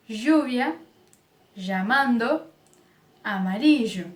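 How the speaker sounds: lluvia, llamando, amarillo with ll as zh; zh sound. Shown as a demonstration. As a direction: zh for every ll. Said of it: "o som de J". (ll com som de j)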